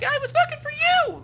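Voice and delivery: put-on very high, piping child's cry; a small kid pleading; little boy voice. Frill the boy crying out to his father.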